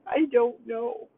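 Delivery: high, slow whimpering answer; crying child. Retold or imitated. Young granddaughter answering grandma while crying, high and slow. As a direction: wail it like a tearful little girl. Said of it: "She's crying".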